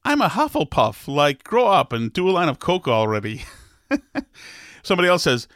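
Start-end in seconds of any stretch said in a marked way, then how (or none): 0.0-1.1 much higher, mocking voice of a grown fan; mocking millennial. Podcaster mocks thirty-something millennials, high mock voice. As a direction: parrot them in a high mock voice. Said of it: "they be thirty-four talking about".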